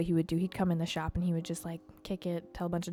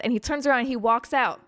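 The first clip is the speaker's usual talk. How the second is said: ordinary talk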